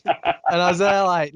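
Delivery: ordinary talk, nothing marked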